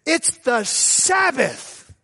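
slow, high, prim scold of the Sabbath; stern Lucy. Retold or imitated. Date scolding pastor for checking the game, slow prim high voice. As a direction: drag out a prissy high rebuke. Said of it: "And she said".

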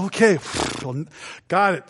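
normal talking voice

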